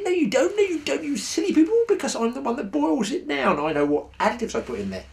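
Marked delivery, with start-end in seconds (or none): 0.0-3.6 very high, teasing contradiction; mock scolding retort. Angler answering silly people about his secret oil, high mock scolding. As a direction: scold them playfully in a high, squeaky pitch.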